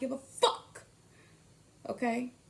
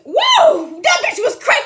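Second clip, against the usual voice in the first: outraged shriek; 21 dB louder, slower than usual. Pretend. Acted outburst in phone skit.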